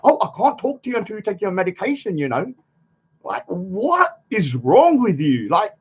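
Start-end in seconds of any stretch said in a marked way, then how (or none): 0.0-2.5 higher, sing-song mock of a fussy carer; a higher, sing-song nurse parody; mocking imitation. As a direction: taunt him like a fussy nurse. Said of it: "I'm not here to freaking".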